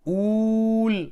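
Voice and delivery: ऊल loud and high with a long ऊ; isolating the ool sound. Shown as a demonstration. Teacher to children, high loud ऊल. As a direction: pull out the ऊल part on its own. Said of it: "बड़ा ऊ होगा".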